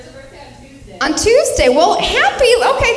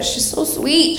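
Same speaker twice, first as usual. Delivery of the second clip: ordinary talk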